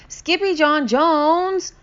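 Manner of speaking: high, loud, drawn-out call; family finding the closet exploding; family cry. Acted. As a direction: call his name in alarm. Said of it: "everyone cried".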